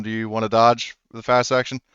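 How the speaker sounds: ordinary delivery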